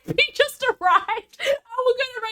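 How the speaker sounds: high, laughing mock dismay. Real, not acted. Streamer laughing as a viewer arrives just before the raid. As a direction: laugh it out, caught out.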